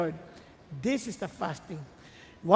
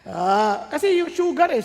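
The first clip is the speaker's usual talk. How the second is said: ordinary talk, nothing marked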